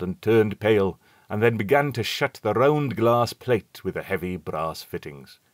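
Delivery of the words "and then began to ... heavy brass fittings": ordinary delivery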